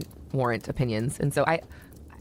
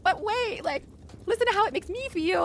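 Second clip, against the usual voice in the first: much higher, pleading mock voice. To her husband, wanting to be heard, high pleading. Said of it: "And I'm like".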